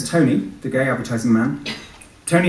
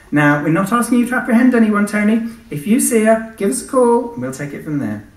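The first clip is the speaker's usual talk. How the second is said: put-on high, louder young policewoman; 10 semitones higher, 5 dB louder than usual. Policewoman instructing Tony before leaving, high voice. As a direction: brightly instruct him, pitched high.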